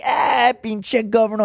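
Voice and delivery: high, drawn-out Spanish jeer; friends making fun. Retold or imitated. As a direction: imitate buddies razzing you in Spanish. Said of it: "they're all making fun of me".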